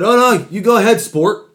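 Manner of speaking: loud, high mock-courteous jab; passive-aggressive. Airline passenger letting him go first, passive-aggressive. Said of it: "Passive aggressive douchebag".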